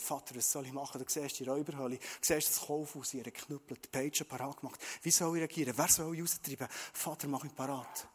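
hushed, soft inner prayer to the Father; Jesus quietly asking the Father what to do. Acted. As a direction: pray it low and intimate, almost to yourself.

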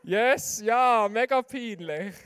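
loud, slow cry of embarrassment; loud slow cringing exclamation; embarrassed outcry. Acted. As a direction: groan it out loud and drawn-out, cringing at yourself. Reliving an embarrassing moment.

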